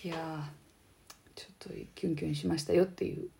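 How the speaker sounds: breathy giddy whisper. Real, not acted. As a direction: whisper it like a secret. Confessing a crush feeling.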